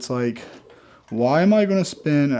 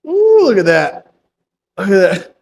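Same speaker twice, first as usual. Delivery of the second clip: high, loud delighted ooh at the muscle; excited admiration; 7 semitones higher, 9 dB louder than usual. Real, not acted. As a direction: show off the pump, thrilled. Admiring his pumped muscle on camera.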